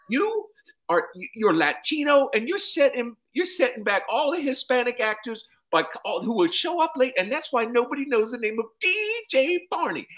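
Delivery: put-on high, scolding old man voice; lecturing Cosby impression. Comic as Cosby scolding him in front of cast, high lecture. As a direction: wag a finger in a squeaky lecture.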